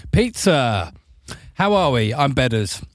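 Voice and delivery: ordinary talk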